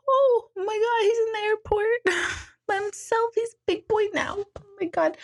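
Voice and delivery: high, teary voice breaking into a laugh; half-cry it through tears of pride; reliving sobbing. Retold or imitated. Mother at the airport, crying over her grown son. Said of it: "So sobbing".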